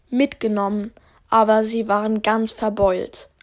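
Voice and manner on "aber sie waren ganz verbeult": ordinary talk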